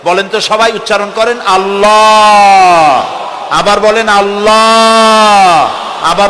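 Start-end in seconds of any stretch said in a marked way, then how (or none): 1.4-3.1 আল্লাহ loud, last vowel rounded and held long; drawn-out rounded pronunciation. Shown as a demonstration. Preacher leading everyone to pronounce it, long rounded call. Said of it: "বলার সময় মুখটা গোল হয়ে যাবে". 4.1-5.7 আল্লাহ loud, last vowel rounded and held long; drawn-out rounded pronunciation. Shown as a demonstration. Preacher leading everyone to pronounce it, long rounded call. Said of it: "বলার সময় মুখটা গোল হয়ে যাবে".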